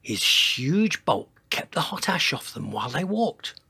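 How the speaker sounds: plain reading voice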